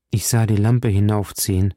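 plain reading voice

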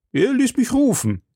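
high-pitched, self-important boast; proud. A narrator's reading. Shopkeeper boasts to his wife of the Cardinal, proud. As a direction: puff it up with pride. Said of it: "erwiderte der Krämer stolz".

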